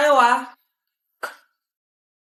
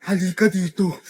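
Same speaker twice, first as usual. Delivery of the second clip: much lower, eerie menacing call; creepy voice; 11 semitones lower, 4 dB quieter than usual. Acted. Strange figure luring the child closer, low eerie voice. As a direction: lure them in low and sinister.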